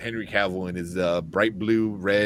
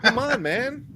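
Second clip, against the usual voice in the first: much higher exasperated whine; 13 semitones higher, slower than usual. Retold or imitated. Complaining The Rock spoiled the scene, exasperated. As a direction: groan it out at the spoiler. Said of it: "I was like".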